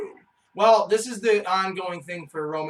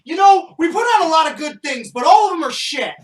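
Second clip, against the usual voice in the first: sarcastic; 8 semitones higher, 10 dB louder than usual. Pretend. Sarcastic loud self-promo.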